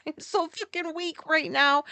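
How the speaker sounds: laughing through the words; words spilling out mid-laugh. Real, not acted. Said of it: "I couldn't stop laughing".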